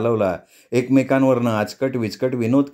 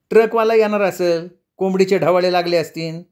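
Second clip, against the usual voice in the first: higher, taunting woman's voice; wife's voice; 6 semitones higher than usual. A narrator's reading. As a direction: play the wife needling Benwa. Ruka's wife taunts. (बेनवाला टोमणा मारणारी रुकाची बायको, उंच आवाजात)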